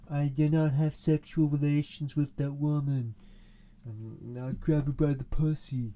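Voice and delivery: loud, hammed-up politician voice; Donald Trump. Politician on live television, loud mocking impression. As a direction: declaim it like a mock public statement. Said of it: "was like".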